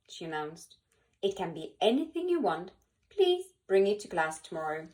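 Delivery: plain reading voice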